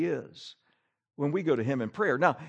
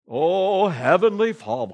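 mock pompous prayer; 2 semitones higher, 6 dB louder than usual. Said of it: "do our prayers go something like this".

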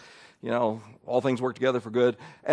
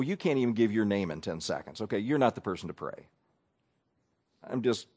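ordinary talk, nothing marked

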